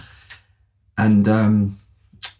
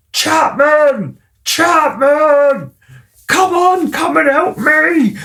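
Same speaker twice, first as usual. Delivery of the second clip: high, loud calling out across the pond; retold shout; 4 dB louder, much slower than usual. Friend shouting across the pond for help, loud call. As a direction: yell for your mate to come help. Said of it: "I can hear him shouting to me".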